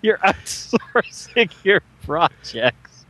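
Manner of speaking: high, slow teasing announcer; drawn-out dramatic trailer voice; mock announcer.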